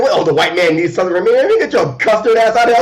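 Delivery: high, loud, mocking sneer; mocking hero brushes off Xavier; cocky hero retort. Quoting Thunderbird's retort.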